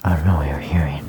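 airy whisper, half-joking; whispered ASMR voice. Pretend. Trying the ASMR airy voice for listeners. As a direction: breathe the words like a mock ASMR video. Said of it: "talk in that weird, airy voice".

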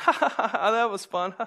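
Joker-like laughing. Retold or imitated. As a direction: laugh it out like a gleeful madman. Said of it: "like I was driving with the Joker".

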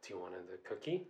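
low, hushed, quick offer; dark creepy date. Retold or imitated. Storyteller imitating her date offering another cookie, creepy hush. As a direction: imitate a sinister lover under the breath. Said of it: "He turned dark all of a sudden".

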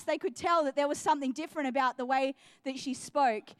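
usual speaking voice

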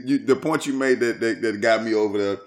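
ordinary talk

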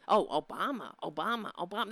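low, mock-adoring chant of Obama; droning fawning supporter; mocking Trump fan. Mocking Trump fans adoring Obama, low chant. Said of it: "the Trumpansies eat it up".

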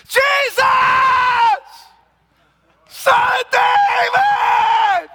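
full-throated high shout, long drawn out. Retold or imitated. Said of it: "he cried out all the more".

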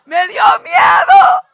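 very loud, high, drawn-out comic scream; frightened shriek. Retold or imitated. Comic reliving of her fright.

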